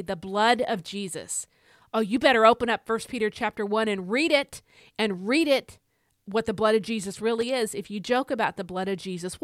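normal talking voice

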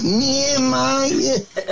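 a hint of Johnny's voice; imitating Johnny. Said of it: "said to him".

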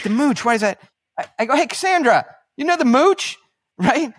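high, loud questioning, rising at the end; feigned bafflement. Retold or imitated. Host asks Cassandra about the guest, mock baffled. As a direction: act clueless and call out to check the nickname.

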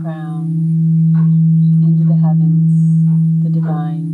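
slow meditation voice over bowl; guided meditation. Acted. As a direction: guide each breath, unhurried.

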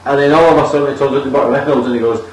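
ordinary delivery

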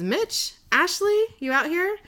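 lightly acted calling for missing friends. Girl calling for her friends in the dark, lightly acted calling out.

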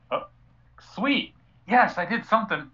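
high, squeaky pleased voice; excited squeal. Retold or imitated. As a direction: squeal it like a proud kid. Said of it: "just kinda like".

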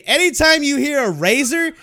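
loud, high-pitched exasperated retort; retold yelling back. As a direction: yell the answer back through the house. Retells yelling back to his wife.

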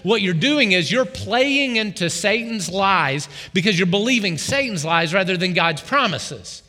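normal talking voice

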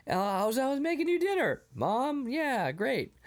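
high, mock-teen fumbling; flustered excuse. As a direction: act out a son covering up. Son makes excuses to mom.

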